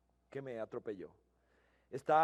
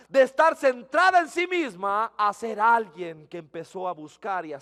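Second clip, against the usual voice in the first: preaching at full force; 5 semitones higher, 12 dB louder than usual. Acted. Pastor urging the congregation, loud and impassioned preaching.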